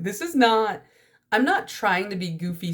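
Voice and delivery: normal talking voice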